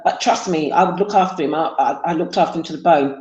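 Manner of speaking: ordinary delivery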